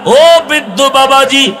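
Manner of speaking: ordinary delivery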